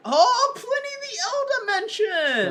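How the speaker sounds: high, loud fanboy whoop; hammed-up, high-pitched celebration; excited cheer. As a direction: cheer it like a crowd-pleasing shout-out. Mock cheer at a favourite name.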